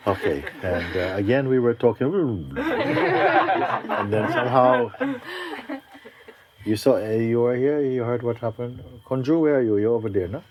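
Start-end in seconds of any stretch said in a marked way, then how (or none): 2.6-4.9 laughing. Real, not acted. Laughs mid-sentence at the story.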